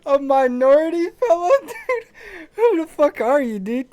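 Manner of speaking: very high-pitched, put-on outrage; mock-offended voice. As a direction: squeak it out in pretend offence. Mock outrage at friend's wording.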